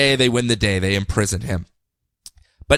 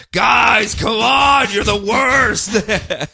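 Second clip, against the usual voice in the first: much higher and louder mock shout, slowing at the end; screaming Batman; 8 semitones higher, 3 dB louder, much slower than usual. Batman yells at the Justice League in mock exasperation. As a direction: yell at the team in exasperation. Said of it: "Batman screaming like".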